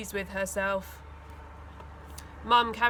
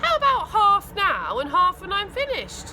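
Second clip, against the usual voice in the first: higher, louder brisk counter-offer; bargaining mum; 8 semitones higher, 6 dB louder than usual. A narrator's reading. Mum haggles with her son over payment. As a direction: haggle back firmly.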